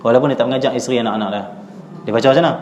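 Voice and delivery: ordinary talk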